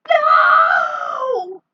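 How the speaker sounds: very high, very loud cry of no; anguished shout. Acted. Teammate screaming no as the last bouncer falls. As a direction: scream no in despair.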